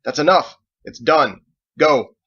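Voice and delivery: loud, curt final orders; angry dismissal. Acted. Michael cuts Edward off with loud curt orders. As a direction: cut him off hard. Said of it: "Michael cut him off".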